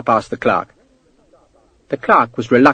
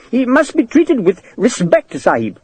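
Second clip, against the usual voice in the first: higher, nervous warning; 10 semitones higher than usual. Acted. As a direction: caution him anxiously. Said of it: "the clerk said nervously".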